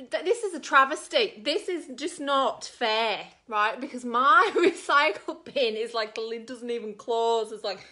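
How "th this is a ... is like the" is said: outraged protest. Retold or imitated. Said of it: "And I said".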